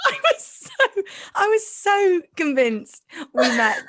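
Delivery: high, giggling through the words; laughing while talking. Real, not acted. Laughing at own blunder.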